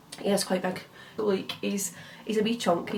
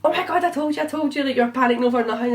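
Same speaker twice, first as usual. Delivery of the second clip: excited friends; 5 semitones higher, 3 dB louder than usual. Retold or imitated. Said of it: "were replying like that".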